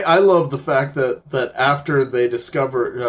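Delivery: ordinary talk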